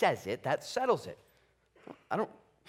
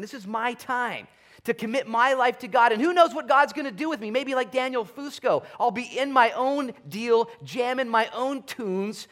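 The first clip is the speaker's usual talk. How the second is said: rising excitement; 8 semitones higher, 6 dB louder than usual. Retold or imitated. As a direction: swell into a fired-up commitment. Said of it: "to say".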